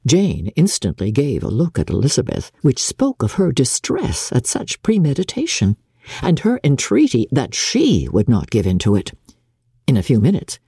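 ordinary delivery, nothing marked

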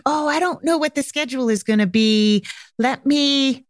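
acted noncommittal guesser; hesitant hedging. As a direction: put them off without saying no. Acted guesser dodging the question.

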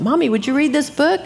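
sweetly wheedling mock child voice; pleading child. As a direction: lightly imitate a little kid's request. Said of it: "they loved me to read to them".

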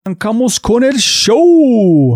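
hyped radio host introducing the star guest; podcast announcer intro. Acted. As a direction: announce the guest like a big show opening. Host announces guest over music.

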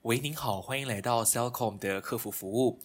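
slow customer service recording voice; phone menu voice. Retold or imitated. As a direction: read it like an automated hotline. (慢速客服语音)

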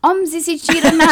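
high, loud nonsense said in reverse; backwards speech. Pretend. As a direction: say the phrase backwards like a rewound tape. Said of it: "Adesso ne fai una al contrario, parlando al contrario".

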